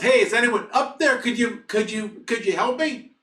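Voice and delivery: high, loud yell up out of a hole; yelling for help. Retold or imitated. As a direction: shout pleadingly to whoever is above. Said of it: "And he yells".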